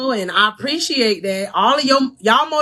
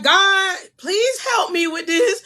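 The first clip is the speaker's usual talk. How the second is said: higher, pleading cry for help; mock pleading prayer; 9 semitones higher, slower than usual. Coach imitating believers praying, higher pleading plea. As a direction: beg God for help, pitched up. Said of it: "or when we be like".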